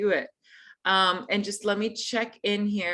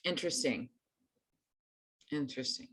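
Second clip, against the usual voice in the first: murmured; 7 semitones lower, 12 dB quieter, faster than usual. Real, not acted. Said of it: "reacting to show of hands".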